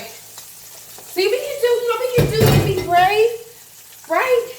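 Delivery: high, loud, brave cheer; squeaky triumphant we-can-do-it; high excited self-cheer. Real, not acted. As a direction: psych herself up bravely. Self-encouragement after fear.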